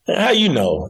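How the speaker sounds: sudden high disbelieving question; retold shock, pitched high; surprised. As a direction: jump way up in pitch, caught off guard. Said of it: "And I was like".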